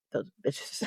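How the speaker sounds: low whispered curse; whispered insult. Real, not acted. About the pharmacy staff, a low whispered curse. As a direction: hiss it under your breath.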